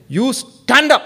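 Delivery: loud, high urgent order; urgent call to stand up now; urgent command. Shown as a demonstration. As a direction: snap them to their feet. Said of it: "It shows urgency".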